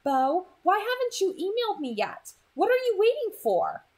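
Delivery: an impatient, fretting mom; mom's rising alarm; worried mom. A narrator's reading. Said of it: "It was sent six hours after the first".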